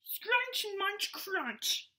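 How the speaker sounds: munch it out like a cartoon; silly high crunch-munch effects for kids; crunching noises. Shark chomping junk food.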